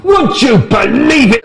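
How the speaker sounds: loud, high, shocked shout; shocked outburst. Acted. Friend reacting to Eileen's news with a loud shocked shout. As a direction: blurt it out in loud disbelief. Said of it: "And I said".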